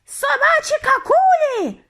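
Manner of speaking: very high, loud witch screech; shrieking alarm, drawn out. A narrator's reading. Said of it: "заверещала вона".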